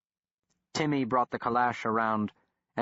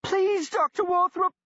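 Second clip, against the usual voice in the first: high-pitched English squeal; 5 dB louder than usual. A narrator's reading. Pinned man begging the doctor, high English squeal. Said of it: "squealed in a high-pitched English accent".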